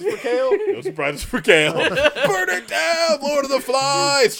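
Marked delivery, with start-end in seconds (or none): 1.7-4.4 high, slow, drawn-out yell; hammed-up rampage scream; mock war cry. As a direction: howl it like a tiny rebel leader. Imagined kid's rampage.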